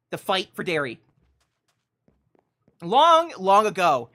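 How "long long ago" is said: loud, drawn-out once upon a time; storybook opening. Acted. Host opening Super Cow's tale, grand storyteller voice. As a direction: boom out the fairy-tale start. Opens the mock fairy tale.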